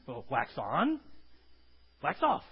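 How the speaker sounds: a lightly voiced Mister Miyagi teaching; Miyagi line. Miyagi voiced in retold film.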